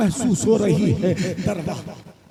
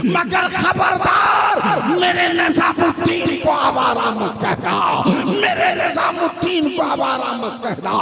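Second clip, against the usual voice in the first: fierce warning; 7 semitones higher, 3 dB louder than usual. Acted. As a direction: defy the husband with full force. Wife furiously defends her saint. (शौहर को गुस्से में ललकारना)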